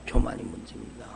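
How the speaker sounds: soft echo. Acted. Preacher echoes his point softly.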